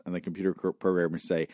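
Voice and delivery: ordinary talk, nothing marked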